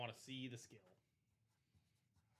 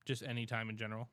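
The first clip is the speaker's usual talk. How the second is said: said again much louder so it's heard; louder repeat; 9 dB louder than usual. Real, not acted. Repeating louder for a cohost who missed it. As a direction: raise the voice to be heard.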